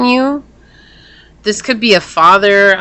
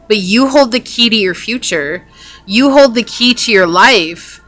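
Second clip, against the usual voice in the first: ordinary talk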